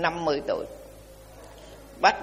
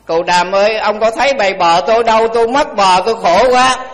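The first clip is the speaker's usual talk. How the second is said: loud, higher, panicked wail; panicked crying; 4 semitones higher, 7 dB louder than usual. Retold or imitated. Farmer again begging the Buddha for his cows, loud panicked wail. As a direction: cry it out like a desperate farmer. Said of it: "Khóc mà hốt hoảng lắm".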